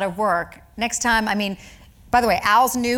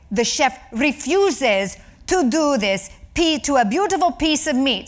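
put-on Italian waiter accent; 5 semitones higher than usual. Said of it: "He sounded a little Italian".

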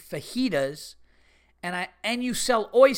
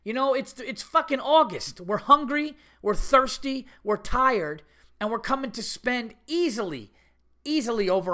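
high-pitched, louder indignant outburst; exasperated rant; 7 semitones higher, 3 dB louder than usual. Real, not acted. Telling the audience about the rude waitress, exasperated high rant. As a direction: vent the outrage, voice climbing high.

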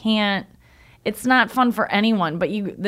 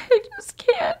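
high, choked mock sob on the line; a light take on a crying pop star; imitating sobbing. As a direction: retell her tearful breaking voice, high and strained. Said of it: "sobbing in this chair".

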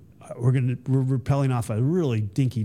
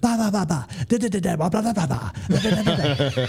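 high nonsense-syllable mock chant; faking the creed; 5 semitones higher than usual. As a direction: bluff through the words you don't know in rhythm. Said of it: "And I get to the next one, I'd be like".

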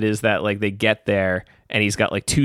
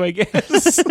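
laughing; 3 dB louder than usual. Real, not acted. Mocking the rich guy's motive.

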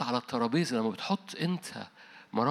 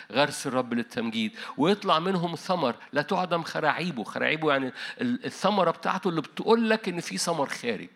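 louder, higher fervent preaching; preaching at full force; 5 semitones higher, 4 dB louder than usual. Acted. Preacher rousing the congregation, loud and fervent. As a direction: build the sermon to a rousing peak.

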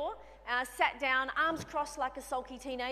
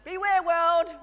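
hammed-up loud proclamation, higher; a playful grumpy proclamation, much louder and higher; mock warning shout; 7 semitones higher, 15 dB louder, slower than usual. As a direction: announce your bad mood to the world, playfully. Mock grumpy announcement to world.

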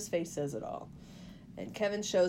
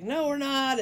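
higher, stubborn mock retort; pissed off; 6 semitones higher, 4 dB louder than usual. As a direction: snap back in a retold car argument. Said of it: "the more pissed off she gets".

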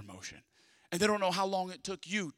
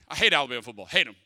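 mock hate; 6 semitones lower, 7 dB louder, faster than usual. Mock rant about rival team.

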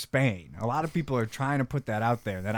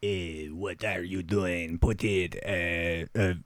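slow, lower Russian spy voice; hammed-up Russian accent, drawn out; 5 semitones lower, much slower than usual. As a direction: play a Soviet agent, heavy Russian accent. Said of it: "honestly pretty good accents".

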